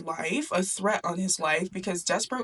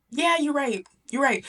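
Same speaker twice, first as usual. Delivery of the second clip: lightly voiced princess, pitched up; a higher, lightly acted giving-in; conceding princess; 7 semitones higher, 3 dB louder than usual. Princess giving in to her father, lightly voiced high concession.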